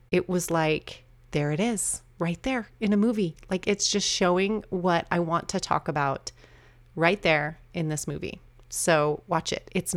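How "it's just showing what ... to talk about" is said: ordinary talk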